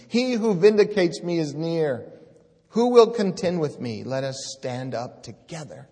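preacher thunders the servant's defiance; defiant scripture proclaimed. Acted. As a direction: rise to a challenge, who will contend. Reads verse seven building loud.

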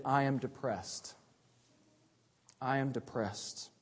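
soft, low, heavy repetition of a sad confession; quiet confession. Acted. Preacher quietly voicing the psalmist's despair, low and heavy.

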